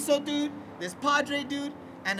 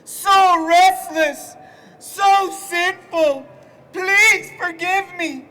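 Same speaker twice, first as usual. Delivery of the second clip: loud, slow, high anguished priest voice; mocking tortured padre; 8 semitones higher, 12 dB louder, much slower than usual. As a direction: ham up a guilt-wracked friar. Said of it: "all sprung on this Esalen girl, like".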